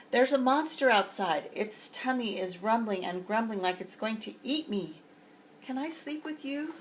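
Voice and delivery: high, frightened cub voice; Baby Bear begging Papa to share his bed; scared little bear. Acted.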